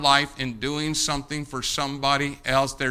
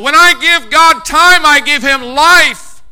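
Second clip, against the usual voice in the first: loud, higher, slow sermon peak; preaching at full force; 10 semitones higher, 11 dB louder, slower than usual. Acted. Preaching climax.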